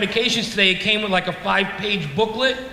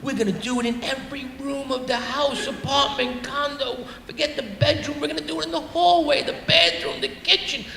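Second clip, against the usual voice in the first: higher-pitched, mock-eager list; excited fantasy; 4 semitones higher, 4 dB quieter than usual. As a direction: build upward as the deluded bachelor gets carried away. His deluded single self fantasizes.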